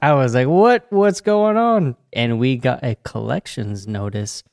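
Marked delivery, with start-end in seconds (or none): 0.5-1.9 mock-shocked retold What, higher and slow; surprised. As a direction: play up the disbelief, high and drawn out. Retells shock at being served.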